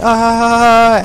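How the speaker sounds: high, loud mock scream; marine scream. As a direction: yell as the marine gets mauled. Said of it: "He's just screaming constantly".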